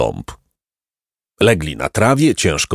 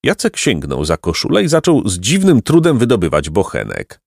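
usual speaking voice

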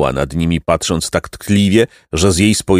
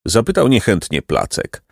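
ordinary delivery, nothing marked

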